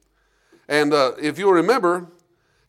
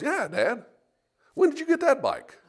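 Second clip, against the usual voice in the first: put-on kid's voice; 11 semitones higher than usual. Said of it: "my kids came out and goes".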